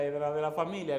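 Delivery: ordinary talk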